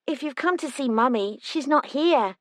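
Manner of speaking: put-on a chatty small girl confiding; a hint of a young twin; little girl. Twin Ivy speaks.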